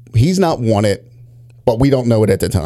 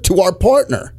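ordinary delivery

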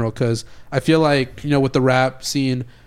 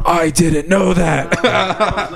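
laughing; 4 semitones higher, much slower than usual. Real, not acted. Surprised by the news, laughing.

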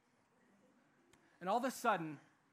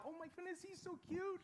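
put-on high, soft, swooning girl voice; gushing girl; 10 semitones higher, 9 dB quieter than usual. Preacher imitating girls swooning over a cute guy, high and soft. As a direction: gush like a girl with a crush. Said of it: "Or girls, you're like".

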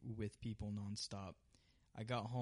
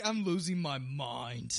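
mock frustrated cry; 3 semitones higher, 8 dB louder, slower than usual. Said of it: "you're like".

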